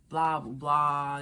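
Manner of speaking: drawn-out, bored blah blah; mocking dismissal. Real, not acted. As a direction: brush it off slowly, mocking her. Mocking the sob story.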